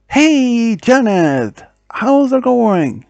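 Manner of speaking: high, drawn-out friendly call; cheery greeting. Acted. Friend greeting Janet at school, cheerily calling out.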